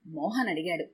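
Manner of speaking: plain reading voice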